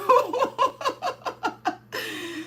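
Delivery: high, drawn-out peal of laughter; hollering laughter. Real, not acted. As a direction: crack up in high, helpless laughs. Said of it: "I'm hollering so loud".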